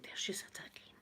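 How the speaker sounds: hushed neighbour whispering; very soft mock neighbour whisper; gossiping whisper. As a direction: whisper it like nosy neighbours tutting.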